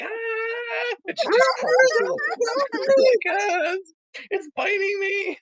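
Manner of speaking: high, loud, fake terrified shriek; high hammed-up scream that the dog bites; mock Karen shriek. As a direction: throw yourself down screaming. Karen faking a dog attack.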